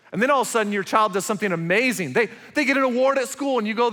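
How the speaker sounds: loud, high, driving sermon voice; forceful preaching. Acted. Pastor telling parents about a child's award, forceful preaching. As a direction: push the story forward with force.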